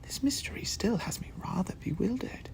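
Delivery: breathy whispered puzzlement. Acted. Watson whispers to Sherlock, bewildered. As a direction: whisper it as if afraid to be heard. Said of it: "Watson whispered".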